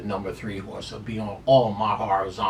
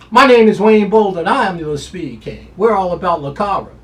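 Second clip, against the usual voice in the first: sign-off catchphrase; 7 semitones higher, 9 dB louder than usual. Real, not acted. Signature sign-off repeated.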